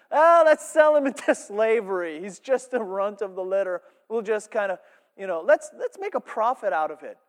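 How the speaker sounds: mocking scorn. Judah mocking his brother.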